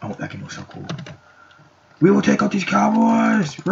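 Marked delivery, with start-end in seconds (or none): 2.0-3.7 high, loud mock war cry; rally the troops with a yell; hammed-up charge shout; battle cry. War cry leading the Indian side.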